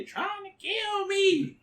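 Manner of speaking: high, drawn-out mock yell; imitated yelling. Co-host imitating Martin Lawrence running in the street, yelling. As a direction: stretch out a high-pitched imitated cry. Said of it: "running in the street yelling".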